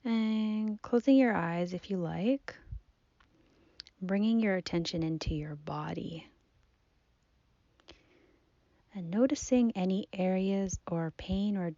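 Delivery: soft, unhurried meditation voice with long pauses; guided body scan. Acted. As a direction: gently guide the listener's attention through the body. Leads a seated body scan.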